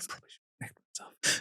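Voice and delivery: breathy whisper under the breath; whispered aside. Real, not acted. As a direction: drop it to a secret whisper. Dark joke aside to host.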